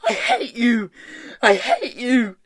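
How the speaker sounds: high, loud hateful outburst; yelling. A narrator's reading. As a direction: yell it twice in fury. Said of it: "Hester was yelling".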